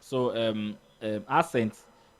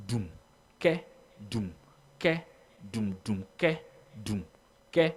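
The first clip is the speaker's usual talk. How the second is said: dum-ke drum pattern, evenly spaced; spoken drum beat. Shown as a demonstration. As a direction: vocalise strong and weak drum beats. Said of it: "this, uh, strong beat".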